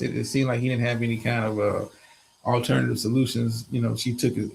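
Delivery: ordinary talk, nothing marked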